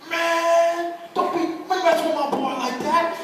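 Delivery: high, loud protective telling-off, acted out; retold angry outburst. Friend defending his boy to the nurse at the hospital, mock-angry outburst. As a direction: imitate a friend going off on someone. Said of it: "he just got drunk on the nurse".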